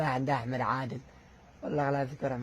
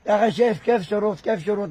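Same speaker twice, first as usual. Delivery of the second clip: loud, high furious protest; outraged shout; 5 semitones higher, 8 dB louder than usual. Real, not acted. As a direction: yell it out in disbelief. Outraged at the conditions. (صرخة استنكار غاضبة)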